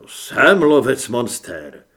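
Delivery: slow, low, determined answer with stress on jsem; firm resolve. Acted. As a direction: answer back with slow certainty, hitting "jsem". Hrdina odpovídá rozhodně. (pomalu a rozhodně, důraz na „jsem“)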